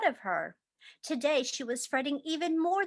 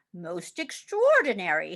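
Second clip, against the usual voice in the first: low, amazed doctor; a doctor marvelling; lightly doctor voice; 6 semitones lower, 3 dB louder than usual. Acted. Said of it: "he exclaimed".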